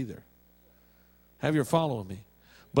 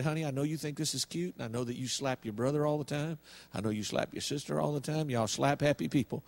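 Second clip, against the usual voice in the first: softer, lower voice, retold; gentle reasoning; 4 semitones lower, 7 dB quieter than usual. Husband talking to his wife about slapping, gently. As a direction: reason softly with a spouse.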